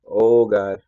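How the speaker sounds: loud, laughing outburst; laughing exclamation. Real, not acted. Driver startled by responsive car, laughing exclamation. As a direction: laugh and blurt it out loudly.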